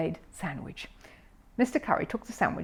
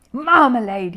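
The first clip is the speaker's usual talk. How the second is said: louder, higher indignant cry; exclaimed; 5 semitones higher, 9 dB louder, slower than usual. A narrator's reading. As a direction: exclaim in disgust. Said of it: "he exclaimed".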